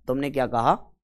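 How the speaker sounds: ordinary talk, nothing marked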